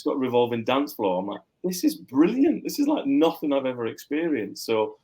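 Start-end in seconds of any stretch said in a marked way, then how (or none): 1.6-4.7 put-on young student bowled over; thrilled. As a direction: gush like a kid seeing it first. Excited student quoting himself.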